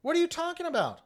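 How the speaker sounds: high, loud, very slow disbelief; incredulous. Retold or imitated. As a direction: stretch San Joaquin out in shock. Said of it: "I go".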